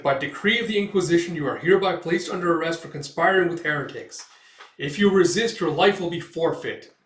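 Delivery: formal arrest. Acted. Soldiers cornering Guts, loud official decree. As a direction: declare the arrest with authority.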